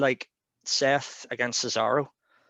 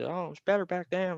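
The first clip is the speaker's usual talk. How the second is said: high-pitched, cartoonish American impression; awful American accent; 7 semitones higher than usual. Podcaster mimicking a wrestling pundit's nostalgia, in an awful American accent. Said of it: "I do an awful American accent".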